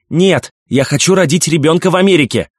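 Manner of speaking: высокий громкий протест роженицы; возражай громко, на высоких нотах; восклицание. A narrator's reading. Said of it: "воскликнула мать". (взволнованный высокий выкрик)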